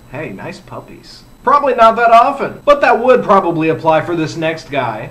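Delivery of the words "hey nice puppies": very hushed, low, slow mock come-on; half-hearted catcall, dropped much softer and lower; mock catcall to a man. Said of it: "looked at a man and said".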